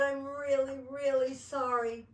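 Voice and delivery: high, loud, pleading apology; distressed child. A narrator's reading. Child to mother who yelled, upset pleading. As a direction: insist on it like an upset little girl.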